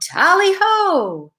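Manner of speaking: high, drawn-out cheerful call; great aunt's rallying cry. Acted. Great Aunt Bleach starting to clean, high cheerful call. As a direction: sing it out like an eager cleaner charging in. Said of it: "Great Aunt Beach yelled".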